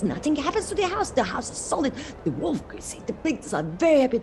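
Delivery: ordinary delivery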